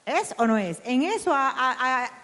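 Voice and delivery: ordinary talk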